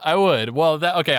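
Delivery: ordinary talk, nothing marked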